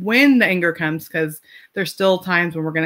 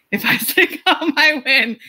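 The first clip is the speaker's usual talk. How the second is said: higher, laughing through "If I stay calm, I win"; laughing mantra; 6 semitones higher than usual. Retold or imitated. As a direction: repeat the mantra again, now chuckling and lifted. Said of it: "I stand at the bottom like".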